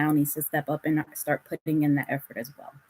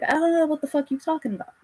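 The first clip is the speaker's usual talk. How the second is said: much higher, fast, put-on voice of a baffled guy; mock clueless man; 10 semitones higher, faster than usual. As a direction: squeak out his confused denial, quick and high. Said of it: "And they're like".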